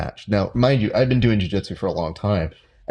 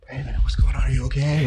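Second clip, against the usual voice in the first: mock worried whisper, a little higher; concerned whisper; 5 semitones higher than usual. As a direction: hush it like asking a hurt friend. Friends checking on him after match.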